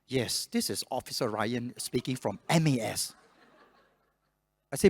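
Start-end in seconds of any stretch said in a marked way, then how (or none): 0.1-3.1 slow, soft, refined English officer voice. Retold or imitated. Scammer posing as an MAS officer, refined English. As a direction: put on measured, refined English. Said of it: "speak with a more refined English".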